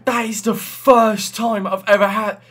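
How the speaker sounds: can hardly get the words out; breathless excitement. Real, not acted. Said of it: "I'm breathless right now".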